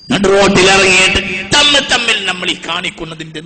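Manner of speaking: loud rebuke, climbing high at the end; full-force preaching. Real, not acted. Preacher shaming his own community for public infighting, full-force preaching. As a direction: cry out the reproach to the congregation.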